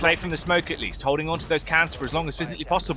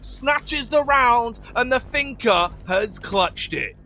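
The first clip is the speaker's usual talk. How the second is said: rising, urgent play-by-play call; esports caster; 9 semitones higher than usual. Acted. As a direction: call the clutch as it happens.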